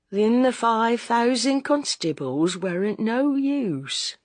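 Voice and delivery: slow, deliberate woman's voice; slowly. A narrator's reading. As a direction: let each word sink in slowly. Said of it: "said Missus Bunting slowly".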